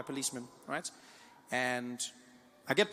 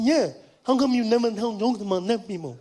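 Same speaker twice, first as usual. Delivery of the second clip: deaf man's speech; 3 semitones higher, 9 dB louder than usual. Retold or imitated. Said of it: "He said".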